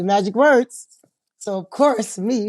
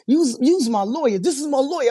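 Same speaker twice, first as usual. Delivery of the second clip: higher-pitched imitation of an eager client; Tyrone impression; 6 semitones higher than usual. Client claims her as his lawyer, mock-eager high voice. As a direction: lightly mimic him, pitched up.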